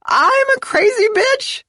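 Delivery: very high, slow, loud howl. Acted. Wife throwing the insult back at Izzy, howling. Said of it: "the woman howled".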